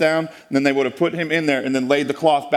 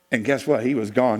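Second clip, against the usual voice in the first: ordinary talk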